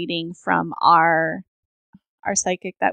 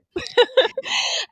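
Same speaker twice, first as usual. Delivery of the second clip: teary laugh. Real, not acted. Laughing about crying.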